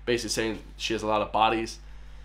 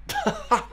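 squeaky high laugh. Real, not acted. Laughing at lyric.

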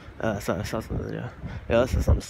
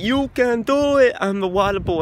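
Waterboy impression; 11 semitones higher, 6 dB louder than usual. Mocking the Waterboy character while reviewing the movie, high and loud. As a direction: squeal it like the dim Waterboy. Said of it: "and I just talk like this".

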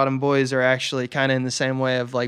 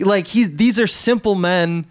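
normal talking voice

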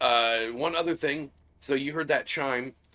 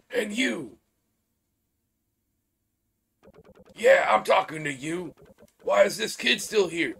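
stern boss; 6 semitones higher, 6 dB louder than usual. Acted. Boss scolds workers in game.

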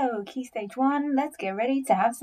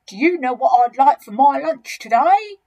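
louder, higher, relishing the question; boastful big crocodile musing on a meal; enormous crocodile, greedy; 4 semitones higher, 8 dB louder than usual. Acted.

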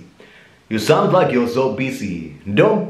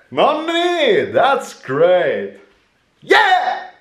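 high screaming voice; 12 semitones higher, much slower than usual. Shown as a demonstration. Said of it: "nobody can do that".

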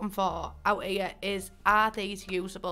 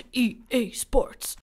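slow, drawn-out announcer voice, a little louder; sports game announcer; 4 dB louder, slower than usual. Retold or imitated. Hoping for a uni sports event, mock game announcer. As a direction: boom it out like the EA Sports intro. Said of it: "just so we can go".

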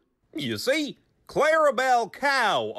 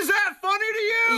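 high, fast, hammed-up crying yell; mock tearful shout; 12 semitones higher, faster than usual. Goofy demanding if Damien finds it funny, mock sobbing shout. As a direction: wail it like a wounded clown.

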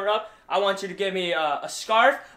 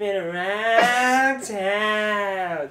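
drawn-out, dragged words; very slow; 2 semitones higher, 3 dB louder, much slower than usual. Acted. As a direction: stretch each word slowly.

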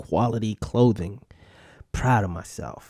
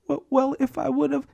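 much higher mock wife voice; defensive wife; 14 semitones higher than usual. Wife answers husband, high and defensive. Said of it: "like something defensive or something".

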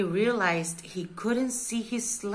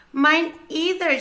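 high, loud penguin exclamation; shouted agreement; 6 semitones higher, 5 dB louder than usual. A narrator's reading. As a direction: pipe up loudly in a raised pitch. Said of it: "shouted the other one".

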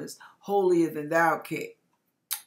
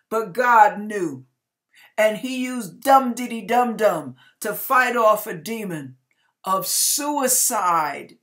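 louder, forceful preaching build; 8 dB louder than usual. Acted. Preacher declaring God fought off the demon, loud preaching. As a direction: drive it home from the pulpit.

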